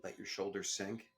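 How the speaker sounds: soft, calm meditation guide voice; guided meditation. Acted. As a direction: ease the listener into the breath, gently. Leads a breathing meditation.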